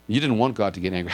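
usual speaking voice

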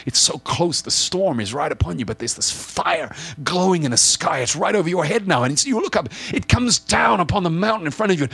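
high-pitched, building storm narration; dramatic preaching. Acted. Preacher putting listeners under the burning mountain, dramatic and urgent. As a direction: build the awe as the fire descends.

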